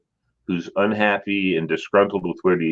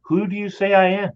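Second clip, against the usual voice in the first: gentle Jesus voice; 9 semitones higher, faster than usual. Retold or imitated. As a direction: ask it gently and knowingly. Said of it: "looked at him and said".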